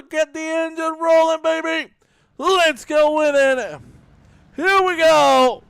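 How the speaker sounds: high, loud race-start call; big, drawn-out commentator hype; hyped-up announcer. Real, not acted. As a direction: fire up the crowd to get going.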